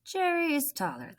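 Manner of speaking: plain reading voice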